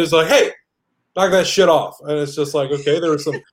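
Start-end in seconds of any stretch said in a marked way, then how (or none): 0.3-2.0 higher, punchy parental telling-off; mock scolding, pitch raised; imitated raised voice. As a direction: cut in like a fed-up parent. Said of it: "we would have raised our voices".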